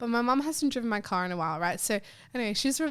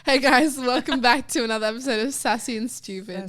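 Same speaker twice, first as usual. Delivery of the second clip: mock show intro; 2 semitones higher, 5 dB louder than usual.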